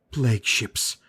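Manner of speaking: usual speaking voice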